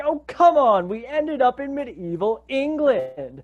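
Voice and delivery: high, mock-exasperated group complaint; exasperated dismay. Characters dismayed at botched wish.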